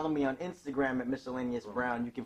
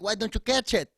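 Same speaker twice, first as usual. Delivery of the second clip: dad scolding; 9 semitones higher, 7 dB louder than usual. Retold or imitated. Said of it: "he'd be like".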